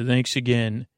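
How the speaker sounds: ordinary talk, nothing marked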